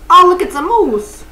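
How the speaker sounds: thrilled to spot it; excited. Real, not acted. Spotting a moose in the game, delighted cry.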